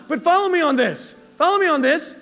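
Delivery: ordinary delivery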